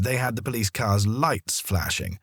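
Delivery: usual speaking voice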